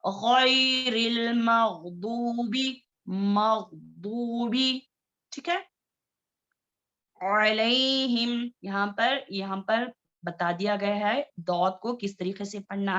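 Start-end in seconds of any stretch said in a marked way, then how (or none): 0.1-4.9 stretched correct recitation; heavy, drawn out. Shown as a demonstration. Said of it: "इसको बारीक नहीं पढ़ेंगे". 7.2-8.5 slow drawn-out अलैहिम with a clear ain sound; slow Arabic recitation of अलैहिम; Quranic recitation demo. Shown as a demonstration. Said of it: "teacher recitation demo".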